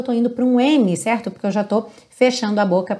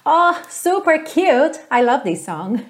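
gushing; 6 semitones higher, much slower than usual. Real, not acted. Gushing over song.

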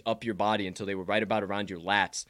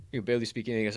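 ordinary talk

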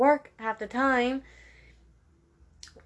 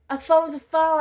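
emphatic; 7 semitones higher, 6 dB louder than usual. Real, not acted. Ranting about phones.

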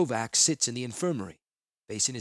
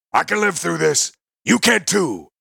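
loud, higher voice of the minotaur Tahngarth; fierce encouragement; 7 semitones higher, 11 dB louder than usual. Acted. Tahngarth urging Krovax not to give up, loud and fierce. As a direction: push hope into him, forcefully.

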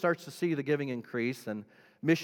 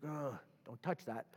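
much softer, muted aside; hushed mock recoil; 11 dB quieter than usual. Preacher mimicking a person objecting to the theology, hushed recoil. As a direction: play someone backing off, voice dropped.

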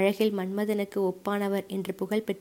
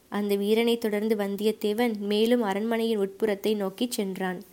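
ordinary delivery, nothing marked

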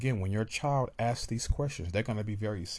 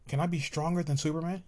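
put-on higher, louder, fast eager kid; child voice; 7 semitones higher, 3 dB louder than usual. Child begging daddy, high and rushed. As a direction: stammer out the wish like a little kid.

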